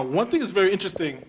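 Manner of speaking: ordinary talk, nothing marked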